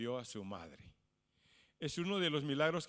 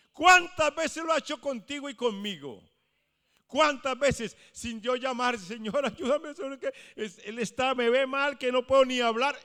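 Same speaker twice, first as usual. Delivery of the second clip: loud, high, urgent appeal to the congregation; fervent preaching; 9 semitones higher, 9 dB louder than usual. Acted. Preacher urging the congregation to remember God's help, loud fervent appeal. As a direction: press the question on every listener, building.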